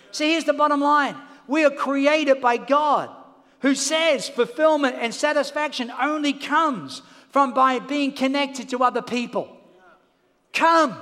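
loud, driving sermon delivery; full-force preaching. Real, not acted. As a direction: preach it hard to the crowd.

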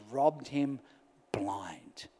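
a low, breathless whispered last word. Real, not acted. Pastor ends the story quietly to the church, whispering. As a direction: let the word fall into a whisper.

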